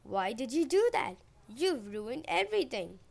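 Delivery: loud, exasperated scolding; angry shouting. A narrator's reading. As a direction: scold them loudly for wrecking your work. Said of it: "Demeter shouted".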